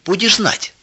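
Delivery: high sharp angry cry. A narrator's reading. Sister finishes punishing brother, angry and high. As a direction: spit it out after the beating. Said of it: "сердито бросила она".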